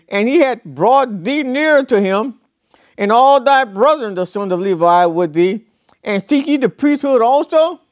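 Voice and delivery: solemn, measured Bible reading; scripture reading. Acted. Preacher reading Numbers to the church, solemn scripture voice. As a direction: read it out like holy writ.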